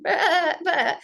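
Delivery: high, panicky mock bleating; frightened sheep bleats. Fleeing lion cub bleating like a frightened sheep. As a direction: bleat like a scared lamb on the run. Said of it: "he's running away from this lion going".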